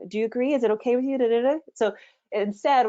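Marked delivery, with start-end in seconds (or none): 0.1-1.6 higher pitch, rising on "agree" and "with you"; string of needy little questions, pitched up; asking for approval. Shown as a demonstration. Coach voicing a speaker seeking outside agreement, high rising questions. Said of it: "you're asking out the side".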